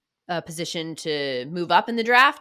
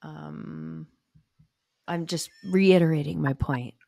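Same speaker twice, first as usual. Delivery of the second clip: whispering; 2 semitones lower, slower than usual. Real, not acted. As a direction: keep it hushed. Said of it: "what are we whispering for?".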